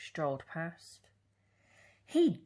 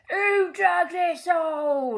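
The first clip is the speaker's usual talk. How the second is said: very loud, high, slow angry bellow; furious captain shouting up; 12 semitones higher, 10 dB louder than usual. A narrator's reading. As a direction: roar it from the bottom of the hole. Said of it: "he bellowed".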